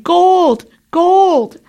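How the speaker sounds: high, drawn-out cry of gold fever; frenzied yelling. A narrator's reading. Frenzied immigrant running off into the desert, yelling. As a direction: call it out high, crazed with excitement. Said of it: "he entered a state of frenzy and ran off into the desert yelling".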